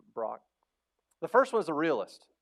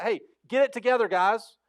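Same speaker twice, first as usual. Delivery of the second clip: put-on raised, higher voice barking orders at the boys; bossy call to the crew; 7 semitones higher, 6 dB louder than usual. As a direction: call them to order, loud and high. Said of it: "Peter's like".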